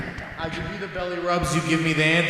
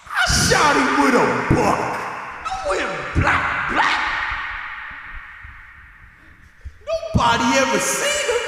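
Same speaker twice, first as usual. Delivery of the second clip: yelling; 7 semitones higher, 3 dB louder than usual. Acted. Dramatic rap climax, yelled.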